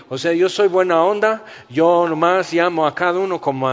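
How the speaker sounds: high, loud, overly friendly pal; mock chummy Jesus. Jesus greeting Paul on the road to Damascus, mock buddy-buddy. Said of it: "no aparece a Pablo en el camino a Damasco diciendo".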